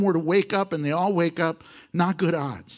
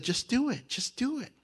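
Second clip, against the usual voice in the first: higher, hushed "just do it" chant; hushed egging on; 6 semitones higher, 7 dB quieter than usual. Retold or imitated. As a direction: soldiers urging softly in the cave. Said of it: "all his men said".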